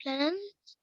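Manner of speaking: normal talking voice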